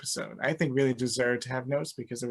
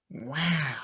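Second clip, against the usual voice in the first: lightly voiced drawn-out Owen Wilson wow; a hint of Owen Wilson's wow; 4 dB quieter than usual. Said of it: "It was an Owen Wilson".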